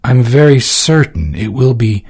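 a hint of nervous politeness; voiced young woman. Valentine carefully voicing her doubts to Orsino.

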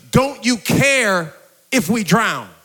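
slow, emphatic plea from worried disciples; acted anxious plea. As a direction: draw it out as a concerned appeal. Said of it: "They get so concerned".

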